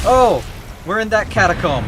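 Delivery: high, loud startled exclamation; scared horror game streamer. Real, not acted. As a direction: still reeling from the scare. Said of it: "that jump scared me".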